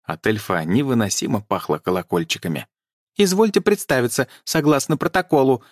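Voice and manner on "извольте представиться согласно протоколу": high, squeaky officious elf call; squeaky elf shout. A narrator's reading. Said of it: "пискляво крикнул незнакомец".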